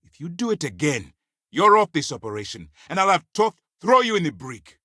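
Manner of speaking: loud, high-pitched warning; angry threat. Acted. Officer threatens to remove subordinate.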